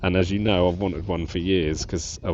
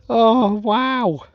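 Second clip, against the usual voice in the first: high and loud wow; elated wow; 14 semitones higher, 6 dB louder than usual. Real, not acted. Detectorist marvels at the coin, high and loud. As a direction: burst out with joy. Overjoyed at find.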